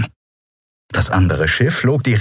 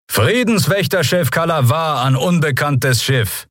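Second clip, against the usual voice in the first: thundering loudspeaker voice; 7 semitones higher than usual. A narrator's reading. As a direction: make an official loudspeaker announcement. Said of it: "donnerte eine Stimme über Lautsprecher". (gebieterische, hohe Lautsprecherdurchsage)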